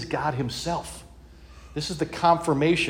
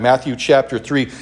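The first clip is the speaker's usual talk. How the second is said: ordinary delivery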